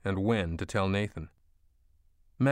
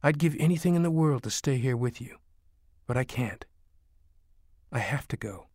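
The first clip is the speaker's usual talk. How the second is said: higher plea sinking to a whisper; understated tearful confession; 4 semitones higher than usual. A narrator's reading. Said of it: "tears streaming down her face".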